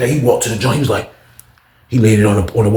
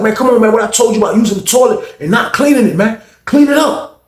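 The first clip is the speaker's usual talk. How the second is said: scolding cellmate; 9 semitones higher than usual. Retold or imitated. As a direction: chew him out over the toilet. Inmate scolds about dirty toilet.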